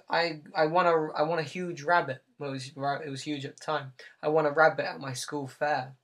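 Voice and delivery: usual speaking voice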